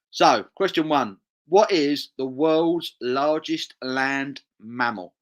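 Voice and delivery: slow, loud quiz-host read. Acted. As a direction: announce it like a pub quiz master. Quiz host reading question.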